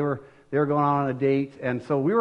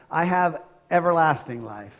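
normal talking voice

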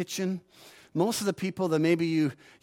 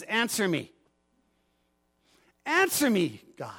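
cry out; 3 semitones higher than usual. Retold or imitated. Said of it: "he cries out to God".

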